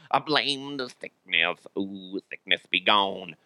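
slow, soft, put-on spooky voice; mock incantation. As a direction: banish it with a drawn-out, hushed chant. Same blame redone as mock incantation.

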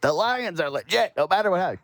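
high-pitched, over-excited broadcaster mockery; Tony Romo losing his mind. Host mocking an overexcited game commentator, high and frantic. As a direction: gush like a commentator getting carried away. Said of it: "Tony Romo losing his mind".

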